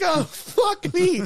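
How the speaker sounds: high, drawn-out exasperated cry; mock exasperation. Teller realizing the pricey phone is lost, comically exasperated. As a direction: wail it slowly in comic dismay. Retelling losing phone after winning.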